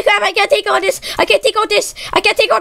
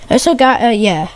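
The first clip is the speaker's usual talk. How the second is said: normal talking voice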